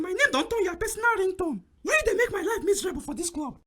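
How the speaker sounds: ordinary delivery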